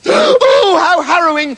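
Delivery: very high "Oh", then a theatrical "harrowing"; mock-tragic mother; hammed-up horror. Mother hears of eaten kids.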